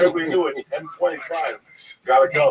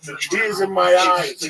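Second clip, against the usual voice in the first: louder, higher, worked-up; excited agreement; 8 semitones higher, 4 dB louder than usual. Real, not acted. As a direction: pile on with enthusiasm. Joins the excited outburst.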